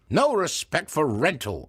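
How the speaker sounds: ranting. Acted. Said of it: "had a rant bubbling up".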